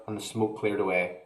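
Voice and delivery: plain reading voice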